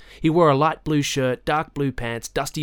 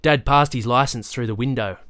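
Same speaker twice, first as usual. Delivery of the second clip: plain reading voice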